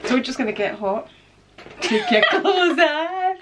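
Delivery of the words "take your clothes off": slow, giggling tease; playful line dissolving into giggles; giggly teasing. Pretend. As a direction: draw it out through laughter. Giggly quip from hot-in-here lyric.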